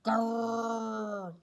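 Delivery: one loud, rough growl. A narrator's reading. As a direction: growl out from down the hall. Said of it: "it started to growl again".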